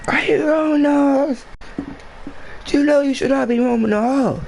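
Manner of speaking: very high falsetto mock scream; screaming teacher. Teacher scolding student for roaming halls, mock screaming. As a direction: screech it like an outraged teacher, without much added volume. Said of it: "screaming at the top of her lungs at me".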